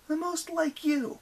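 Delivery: high, slow, goading; girl voice. Acted. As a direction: draw it out to provoke. Annabeth voiced.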